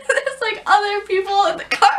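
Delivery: ordinary talk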